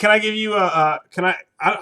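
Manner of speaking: ordinary talk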